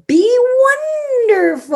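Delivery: high, loud bingo call; bingo caller. Pretend. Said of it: "and I love to do, like".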